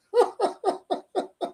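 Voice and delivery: high chuckling laugh. Real, not acted. Speaker amused by the billionaire's huge income, laughing. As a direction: laugh in quick high bursts.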